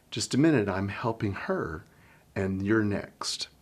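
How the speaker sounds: soft aside. Shown as a demonstration. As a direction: calmly ask the child to wait. Teacher asks child to wait.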